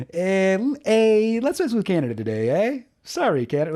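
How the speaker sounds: imitating a hammed-up Canadian, high-pitched on each eh; mock Canadian accent. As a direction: requote the Canadian hacker, jumping up on the eh. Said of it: "a really over-the-top Canadian accent".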